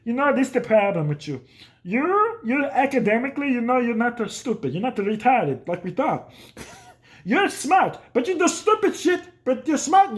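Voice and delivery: high mock exasperated dad; hammed-up baffled father scolding; dad scolding his bookish son; dad lecturing. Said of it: "he's like".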